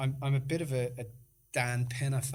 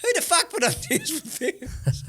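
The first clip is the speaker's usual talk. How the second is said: high, loud and laughing through it; laughing exclamation; 13 semitones higher, 6 dB louder than usual. Real, not acted. Host laughing while retelling the party story, high and loud. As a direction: crack up while asking it.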